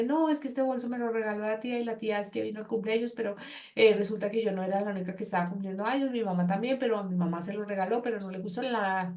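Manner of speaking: fast, breathless off-topic story; rambling chatter. Acted. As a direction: rattle it off without stopping. (charla rápida y sin pausa de la aconsejada)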